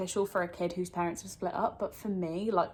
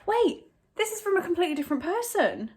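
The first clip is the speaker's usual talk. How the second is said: surprised; 9 semitones higher, faster than usual. Real, not acted. Realises gift from someone else.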